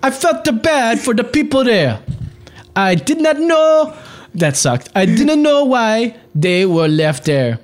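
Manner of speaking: high, slow hammed-up German accent; overdone German accent on the quote; mock German accent. Still mocking the guard's quote in an accent. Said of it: "stronger German accent".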